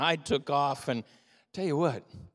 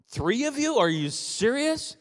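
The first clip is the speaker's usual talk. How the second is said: mock surprise; 10 semitones higher than usual. Mock disbelief at audience.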